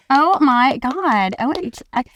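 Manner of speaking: put-on valley girl voice, high, slow on oh my God; host mocks her own American valley girl accent. Shown as a demonstration. Valley girl accent, hammed up. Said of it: "start doing my podcast like this".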